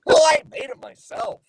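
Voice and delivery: high, loud goofy cartoon voice. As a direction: proudly squeal it like a cartoon dog. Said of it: "I'm gonna do my Goofy voice".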